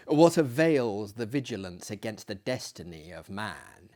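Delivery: usual speaking voice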